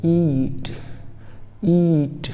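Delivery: teacher demo of ईट; long ee. Shown as a demonstration. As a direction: draw out the ee in ईट. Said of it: "पता चलता है".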